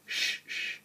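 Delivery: breathy hush to quiet a friend; hushing shh. A narrator's reading. Henry hushing Edward to hear a far whistle, hushed. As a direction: shush him so you can listen.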